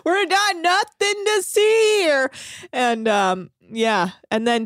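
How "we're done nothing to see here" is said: high, drawn-out mock holler; loose, singsong dismissal; beer-drinking crewman. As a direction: imitate a carefree drinker calling out. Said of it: "drinking beers and".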